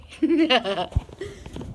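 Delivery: light rising giggle; happy laugh. Real, not acted. Reader laughing at her own cheering, giggling.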